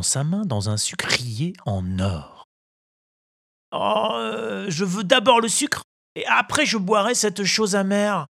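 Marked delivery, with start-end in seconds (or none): 3.7-8.3 put-on high, eager puppet boy; eager child. A narrator's reading. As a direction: ask greedily for the sugar. Pinocchio bargains. (voix d'enfant impatient)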